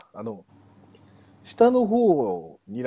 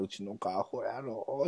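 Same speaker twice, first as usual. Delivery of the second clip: Kansai dialect; 11 dB quieter, slower than usual. Retold or imitated. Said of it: "関西弁で言ったら".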